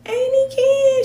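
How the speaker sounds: put-on high, slow little girl voice; four-year-old voice. Voicing her small great niece, high little girl. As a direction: say it like a tiny child. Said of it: "she was like".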